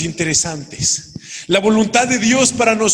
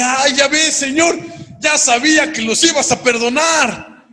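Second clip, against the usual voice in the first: higher, exasperated griping at God; annoyed complaint; 7 semitones higher than usual. Retold or imitated. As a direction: complain to the Lord like a sulking prophet.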